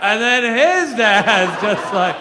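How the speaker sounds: "his" stretched long with a swooping rise; drawn-out stress on HIS. Real, not acted. Comic going back another generation of dads, "his" loudly stretched. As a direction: lean hard and loud on "his", dragging it out. Running bit, escalating lead-in.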